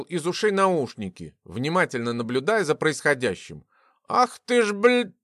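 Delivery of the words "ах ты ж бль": high curse cut short by loud interjection; stunned outburst. A narrator's reading. Stunned Gleb coming to his senses, startled outburst. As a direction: burst out as if coming to. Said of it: "пришёл в себя ошеломлённый Глеб".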